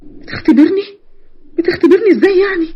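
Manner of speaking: high, tearful questioning; outraged disbelief. Acted. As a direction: echo his excuse in anguish. Victim outraged at being 'tested'.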